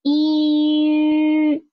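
long "E" held steady as the lips round into ü; E gliding into Yu. Shown as a demonstration. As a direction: draw out "E", tongue still, slowly rounding the lips. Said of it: "try to slowly round your mouth".

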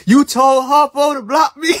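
loud, high call-out with a laugh in it; laughing shout. Real, not acted. Streamer laughing at chat who told Harpo to block him, shouting. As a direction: laugh it out, calling them on it.

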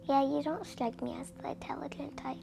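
hissed whisper, snide; whispered insult. Acted. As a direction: whisper the insult behind her back.

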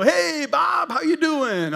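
high, overdone friendly greeting; mock cheery greeting. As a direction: chirp it like a friendly neighbour.

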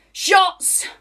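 high, loud call to drink; sudden rowdy shout; party shout. Acted. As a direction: yell it out to the room. Calls out for shots.

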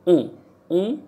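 "O," said loudly through the nose; nasal vowel. Shown as a demonstration. Teacher repeats the French sound for learners, nasalised "O,". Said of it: "like with our nose".

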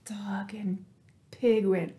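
usual speaking voice

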